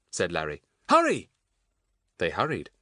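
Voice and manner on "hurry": high, loud, urgent cry; urgent call. A narrator's reading. Larry urging the children after the man, urgent.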